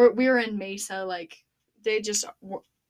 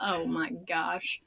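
exasperated sigh; 3 semitones lower, 4 dB quieter, much slower than usual. Retold or imitated. Exasperated at cop.

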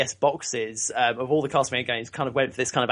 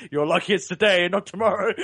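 lightly voiced policeman, raised and laughing; retold policeman; 5 semitones higher than usual. Policeman letting off a street vomiter, lightly imitated. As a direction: retell an officer's warning through amusement. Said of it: "he says".

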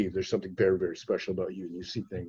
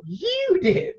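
very high, teary squeak; high frightened little voice; squeaky startled reply. Retold or imitated. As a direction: squeak it out like a scared child. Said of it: "turn around and go".

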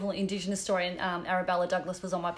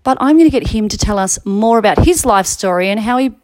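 podcast intro; 14 dB louder than usual. Acted. Host opening the podcast episode.

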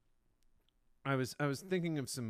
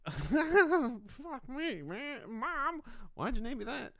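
high, whiny put-on voice; mock complaint; 13 semitones higher, 4 dB louder, much slower than usual. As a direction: squeal it at your mom, hammed-up. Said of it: "I'd be like".

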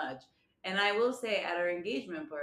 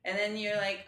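normal talking voice

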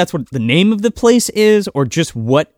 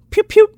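high, louder pew pew gun sounds; mock gunfire pew; 4 dB louder than usual. Podcaster mocks the villain's two rifle shots with a high pew pew. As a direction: make silly toy gun noises up high. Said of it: "he just goes".

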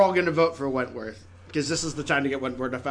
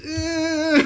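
high, loud unsure Eh; hammed-up hesitant Eh; imitating Keith; 12 semitones higher, 5 dB louder than usual. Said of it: "he goes".

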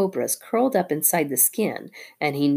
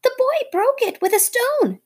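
very high shrill-pitched shriek; shrieking bird wife; 4 dB louder than usual. A narrator's reading. Darzee's wife shrieking at the snake, very high. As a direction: shriek it as the frantic bird's wife. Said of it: "shrieked Darzy's wife".

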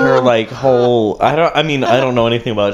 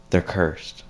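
quieter, understated ominous line; hushed mock warning; 2 semitones lower, 6 dB quieter than usual. Airport screener quoting shaman's warning, hushed delivery. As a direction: drop it softly as a retold warning.

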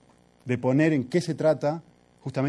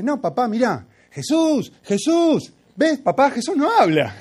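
put-on much higher and louder, a child proving her point; the four-year-old almost shouting her case; little girl insisting loudly; 11 semitones higher, 8 dB louder than usual. Hija insiste con fuerza. (voz muy aguda y fuerte de niña que insiste)

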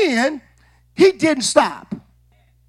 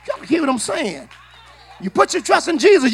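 normal talking voice

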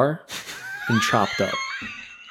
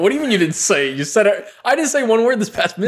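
incredulous protest; 9 semitones higher, faster than usual. Real, not acted. Friend accused of speaking, protests.

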